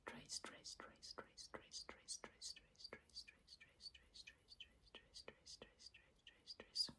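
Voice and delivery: rapid whispered trigger words; quick repeated whispered traces; fast aggressive asmr. Acted. Repeated trigger words while tracing.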